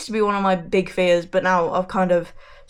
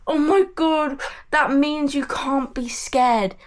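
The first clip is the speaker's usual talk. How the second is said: mocking people; 6 semitones higher than usual. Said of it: "people fucking saying to me".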